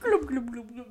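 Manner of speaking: loud burbling fish noises; gurgling fish man croak. Pretend. Playing Mister Fish.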